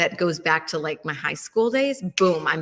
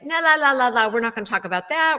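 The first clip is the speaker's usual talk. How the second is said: high, quick la-la blocking out; loud singsong dismissal, rattled off; mock refusal to listen; 5 semitones higher, 4 dB louder, faster than usual. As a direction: drown the topic out playfully.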